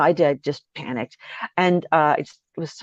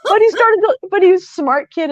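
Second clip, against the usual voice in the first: still laughing; 6 dB louder than usual. Real, not acted.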